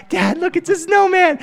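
put-on high, loud small boy showing off; imitated kid's excited shout; excited little kid. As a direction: call out like a thrilled toddler. Excited child shows his dad.

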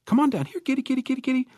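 high sing-song here kitty; kitty call. Acted. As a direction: call the cat down sweetly. Husband calling kitten from ladder.

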